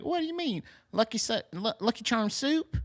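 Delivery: high-pitched put-on confusion; puzzled mother. Mother puzzled by son's request, high mock confusion. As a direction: play a bewildered older lady, questioning in a high voice. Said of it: "she's like".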